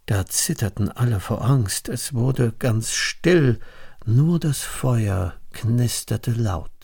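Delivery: ordinary delivery, nothing marked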